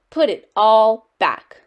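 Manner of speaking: loud, slow, drawn-out order; stern father. A narrator's reading. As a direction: scold firmly, stretching the words. Father scolds child.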